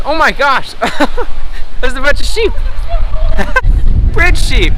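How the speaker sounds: excited. Real, not acted. Delighted at sheep.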